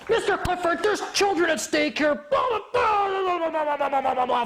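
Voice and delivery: very high, squealing imitation of a judge; hammed-up high-pitched protest; mock ranting judge. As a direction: wail it, dissolving into blah-blahs.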